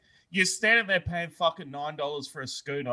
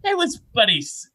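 put-on higher, louder old dad protesting; old man voice; 9 semitones higher, 5 dB louder than usual. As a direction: play the old man brushing it off. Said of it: "And he's like".

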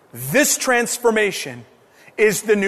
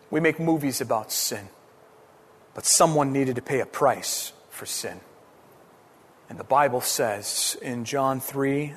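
much softer and lower sermon voice; hushed preaching; 7 semitones lower, 6 dB quieter than usual. Acted. Quiet low preaching stretch.

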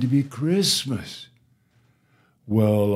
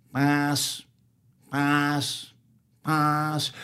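higher, quacked single words; duck quacking; 4 semitones higher, much slower than usual. Acted. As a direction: quack each word like a duck. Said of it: "And then the duck quacked".

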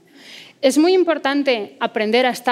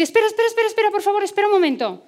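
high, frightened pleading for time; firefly begging the snake to wait, scared and high; scared plea; 9 semitones higher, much faster than usual. Acted. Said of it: "la luciérnaga se asusta y dice".

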